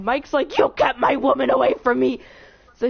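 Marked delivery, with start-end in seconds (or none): 0.6-2.2 loud, hammed-up outrage; mock furious accusation, each word pushed out; Mike, really mad. Mike confronts the sheriff over the girl, mock anger. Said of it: "so they get really mad".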